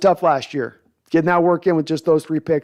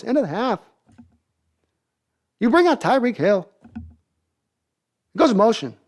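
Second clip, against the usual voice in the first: halting celebrity impression, higher pitch, odd pauses; Christopher Walken; 4 semitones higher than usual. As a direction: break each phrase off with swooping, higher-pitched emphasis. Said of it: "Christopher Walken asking Andy Reid what happened".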